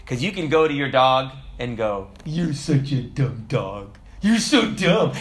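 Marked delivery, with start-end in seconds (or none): 2.2-5.1 put-on slow baby-talk insult, lifting at the end; teasing dog talk. Owner talking to his dog, playful mock praise. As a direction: coo an insult at a dog, slowly at first.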